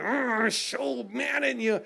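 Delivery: put-on high, slow growl of a furious little kid; angry little kid. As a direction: imitate a shaking, furious toddler. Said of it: "you ever see a little kid shaking".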